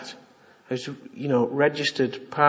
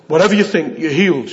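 mock bolshy insistence; 7 dB louder than usual. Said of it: "Not to be".

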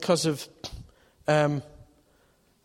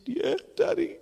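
put-on little girl voice. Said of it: "She said".

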